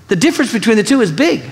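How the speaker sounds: usual speaking voice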